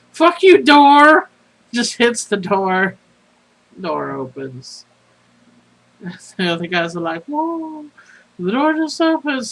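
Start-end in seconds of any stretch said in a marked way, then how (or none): 0.1-1.3 full-throated angry outburst at a door; fed-up player screaming at a cursed door; furious shout. Pretend. Vinny snaps, stomps to door. 7.2-9.5 high, astonished whoa; mock amazement. Party amazed door opens.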